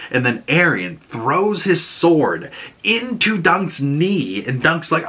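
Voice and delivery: usual speaking voice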